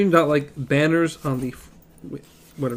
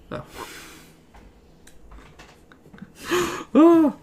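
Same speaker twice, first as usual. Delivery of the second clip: startled gasp; 13 semitones higher than usual. Real, not acted. Reacting to a scary noise.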